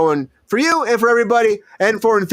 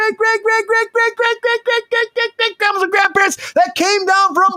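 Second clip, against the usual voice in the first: higher, climbing hype as the greats pile up; excited rant; 6 semitones higher than usual. Real, not acted. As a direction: keep stacking greats, voice rising. Escalating goofy outro rant.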